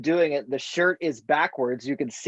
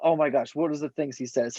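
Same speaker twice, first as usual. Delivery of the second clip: ordinary talk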